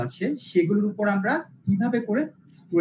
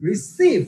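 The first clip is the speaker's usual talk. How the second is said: Receive with সিভ punched high; light রি, then punch সিভ in receive; second-syllable stress; 7 semitones higher, 4 dB louder, much slower than usual. Shown as a demonstration. Teacher giving a verb example, second syllable of receive punched high. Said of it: "second সিলেবলে প্রেশারটা যাবে".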